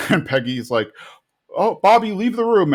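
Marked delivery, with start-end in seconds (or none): 1.6-2.7 high-pitched mock alarm; alarmed order. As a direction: urgently shoo the kid out, voice up high. Peggy sends Bobby out in alarm.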